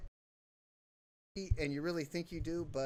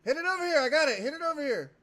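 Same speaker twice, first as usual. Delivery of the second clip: high, loud call; calling for the ball; 9 semitones higher, 8 dB louder, faster than usual. Real, not acted. Asking teammates to pass, loud calling. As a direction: shout for a pass.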